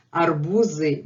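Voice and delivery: "arbuzy" with a clearly buzzed z before the y; clear voiced z. Shown as a demonstration. Teacher shows the plural, z clearly voiced. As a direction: voice the z fully in "arbuzy". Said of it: "you hear z clearly".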